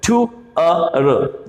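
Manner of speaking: टू अ र very slowly, sound by sound; split-up pronunciation. Shown as a demonstration. Teacher showing an easy trick, slowly enunciated. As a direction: separate टू, अ and र. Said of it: "जुगाड़ वाला तरीका बता दूं".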